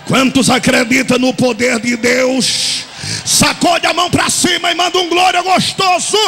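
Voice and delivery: apelo forte ao público; pregação inflamada. Acted. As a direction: puxa o glória da igreja. Apelo à congregação. (pregador pedindo glória em alta voz)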